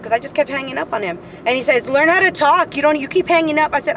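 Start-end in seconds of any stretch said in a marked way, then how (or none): 1.9-3.7 scolding. Retold or imitated. Retold phone quarrel, ex scolding.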